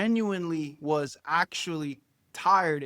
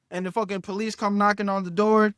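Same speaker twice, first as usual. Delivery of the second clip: ordinary delivery, nothing marked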